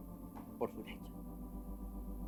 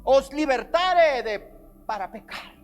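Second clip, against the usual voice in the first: preaching at full force; 10 semitones higher, 12 dB louder, slower than usual. Acted.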